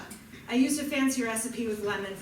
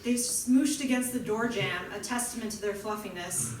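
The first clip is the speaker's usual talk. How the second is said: ordinary delivery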